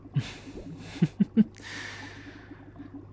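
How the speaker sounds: light, drawn-out chuckle; delighted villain giggle. Acted. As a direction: titter with pleasure at your victim.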